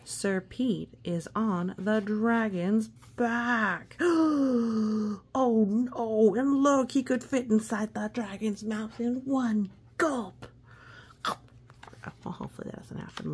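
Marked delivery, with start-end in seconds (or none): 4.0-5.3 loud, high gasp of surprise; big storyteller gasp; dramatic gasp. A narrator's reading. Dragon moves, gasp heard. 5.3-10.5 loud, high alarmed exclaim; storyteller alarmed for the children. A narrator's reading. Reader alarmed at dragon.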